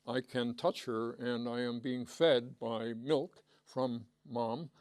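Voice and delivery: ordinary talk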